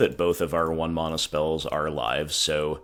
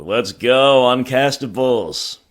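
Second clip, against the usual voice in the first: excited cheer; 7 semitones higher, 9 dB louder, slower than usual. Real, not acted. Cheering a good draw.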